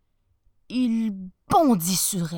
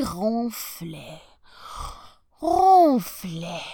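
drawn-out snoring; 6 dB louder, much slower than usual. Acted. Wolf snoring in bed.

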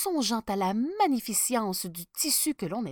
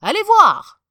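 much higher, much louder order; command; 13 semitones higher, 19 dB louder than usual. Acted. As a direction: bark it at the minister. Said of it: "ordonna-t-il".

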